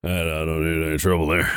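low, softened, hurried line; odd low delivery. Real, not acted. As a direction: drop the voice low and rush it out. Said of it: "I don't know why I said it like that".

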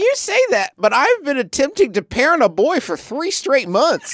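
high-pitched, incredulous self-defence; indignant comic protest. Real, not acted. Player defends parenting a boy to Griffin, high indignant protest. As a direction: protest it in a high, exasperated squeal.

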